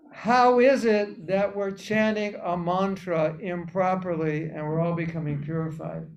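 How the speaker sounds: usual speaking voice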